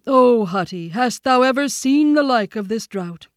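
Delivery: high, loud bear voice; Baloo the bear, appealing. A narrator's reading. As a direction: call out the question to an elder.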